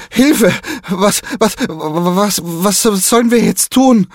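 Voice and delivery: put-on high, panicky stammering boy; terrified stutter. A narrator's reading. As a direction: stutter in fright over every word. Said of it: "stottert Diego, voll von Angst".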